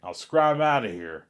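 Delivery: loud, drawn-out dismissal; sending off. Retold or imitated. Cop letting the speeder go, loud acted dismissal. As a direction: send them off loudly, stretching it slowly.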